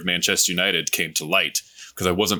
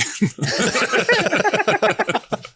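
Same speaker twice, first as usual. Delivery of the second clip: high laugh. Real, not acted. Laughing at own boast.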